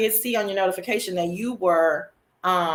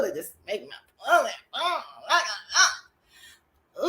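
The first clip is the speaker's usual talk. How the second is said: usual speaking voice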